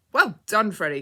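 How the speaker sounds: loud, delighted cry of praise; Mister Discover the Truth cries out praise to Freddy, loud; cried out. A narrator's reading. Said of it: "cried Mister Discover the Truth".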